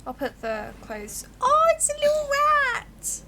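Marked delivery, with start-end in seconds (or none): 1.4-3.2 high squealing shock at a rat; startled squeal. Real, not acted. Player finding an animal in the drawer, high startled squeal. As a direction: shriek at the thing in the drawer.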